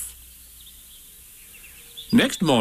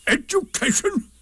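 very high, bitter exclamation. A narrator's reading. Eeyore jumping on his sticks, bitter. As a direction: spit out the word in bitter disbelief. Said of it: "said Eeyore bitterly".

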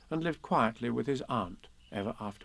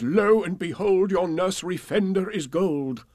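grand spell incantation; 6 semitones higher, 5 dB louder than usual. Pretend. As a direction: intone it like a magic spell. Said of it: "a wonderful spell which says".

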